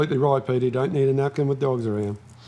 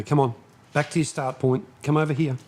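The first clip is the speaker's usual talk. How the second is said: ordinary talk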